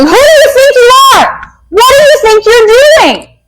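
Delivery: put-on high, sharp scolding of a child; mock scolding parent. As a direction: snap at the kid like an exasperated parent.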